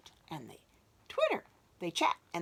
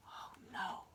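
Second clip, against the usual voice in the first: whisper; 12 dB quieter than usual. Acted. Scared reaction.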